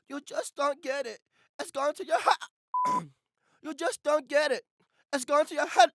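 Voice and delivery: agitated English oddball, cut off at the end; odd English accent, last word chopped short; fidgety English, cut off. Acted. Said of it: "should be cut off because remember he gets shot".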